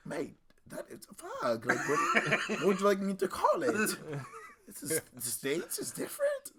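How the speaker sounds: mock British accent, half laughing; lightly hammed-up Brit explaining himself; British man. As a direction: imitate a puzzled UK bloke. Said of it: "this guy from the U K".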